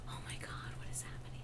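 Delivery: breathless stunned whisper. Real, not acted. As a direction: whisper it in disbelief.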